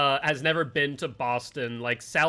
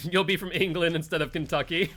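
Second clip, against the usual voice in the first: laughing; 2 semitones higher than usual. Real, not acted. Streamer joking about Frankie's accent guesses, laughing. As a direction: tease through a real laugh.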